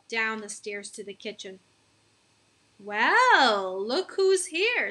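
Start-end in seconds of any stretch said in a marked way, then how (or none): 2.8-4.9 slow, high teasing drawl. A narrator's reading. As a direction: tease him as he stumbles in. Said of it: "said my mom".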